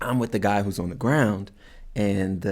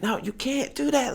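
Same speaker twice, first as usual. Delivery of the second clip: high yell again; 4 dB quieter than usual. Retold or imitated. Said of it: "so I'm just like".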